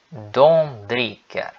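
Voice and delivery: slow, clear repeat-after-me conjugation; långsam övningsuppläsning; slow drill. Shown as a demonstration. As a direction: spell it out slowly for learners to follow. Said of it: "Sigam comigo".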